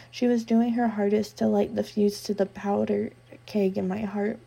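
ordinary delivery

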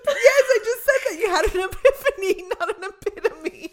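high, loud protest through laughter; laughing. Real, not acted. Host correcting her friend's word mix-up, laughing. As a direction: correct her while cracking up.

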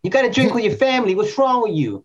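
high hammed-up relative pushing booze; mock pushy relative. Friends mocking movie relatives, hammed-up pushy relative. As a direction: needle them like a pushy uncle.

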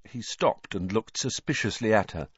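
usual speaking voice

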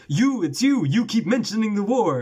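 high, loud mock girl's voice; crying German daughter. Mock upset daughter, high and loud. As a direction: squeal it like an upset child. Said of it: "And he's like".

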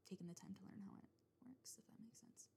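whisper. Real, not acted. Trailing whispered aside.

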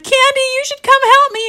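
very high, loud nervous call; nervous plea. Acted. Nervous mom calling her daughter, high pleading shout. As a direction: call for help, hammed-up nerves. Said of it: "she is so nervous".

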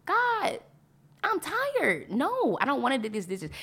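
high, whining tired grumble, lightly acted; whiny complaint. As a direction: play a worn-out soldier moaning to God. Voicing tired Israelites complaining to God.

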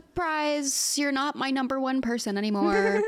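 mocking singsong surprise reveal; mock dad announcement. Dad springing news on his daughter, mock announcement. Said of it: "and was like".

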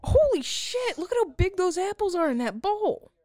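high, loud outburst of amazement; excited shock. Real, not acted. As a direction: blurt it out in shock. Sudden shock at apples.

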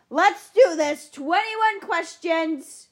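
slow, loud hype-man call; big drawn-out announcer shout; hyped shout. Pretend. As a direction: pump up the crowd.

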